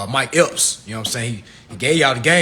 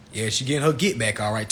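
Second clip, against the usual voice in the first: ordinary talk, nothing marked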